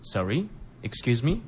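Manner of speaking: sorry, excuse me with a rising end; rising tune. Shown as a demonstration. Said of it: "これポイントは語尾を上げることですね".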